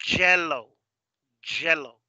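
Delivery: YELLOW said as "JELLO", English J; hard J. Shown as a demonstration. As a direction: model JELLO twice, striking the J. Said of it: "en inglés eso se pronuncia".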